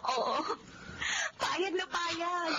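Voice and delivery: tearful yes. Acted. Accepting proposal in tears.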